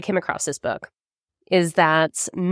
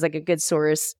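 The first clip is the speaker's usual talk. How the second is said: ordinary talk, nothing marked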